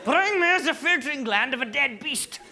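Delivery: loud, high, hammed-up German accent; shouted mock-German demands. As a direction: bellow slow commands in a German accent. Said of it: "How Germanic is that?".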